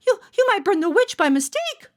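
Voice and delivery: put-on high stammering child voice; worried boy. A narrator's reading. Johnny Brown warning the mayor, high and stammering. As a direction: warn them, stumbling on you. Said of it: "Johnny Brown protested".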